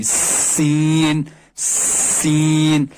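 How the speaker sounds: سین drawn out with a light whistle on the س; hissed seen. Shown as a demonstration. Tutor showing the whistle of سین, slowly drawn out. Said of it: "تھوڑی سی سیٹی نکلتی ہے".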